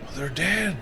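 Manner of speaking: usual speaking voice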